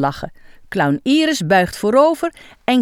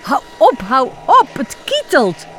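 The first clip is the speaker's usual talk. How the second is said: louder, higher ticklish plea; mock giggling protest; 5 semitones higher, 4 dB louder than usual. A narrator's reading. Tickled clown begs Iris to stop, giggling. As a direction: giggle while begging to stop. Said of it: "moet heel erg lachen".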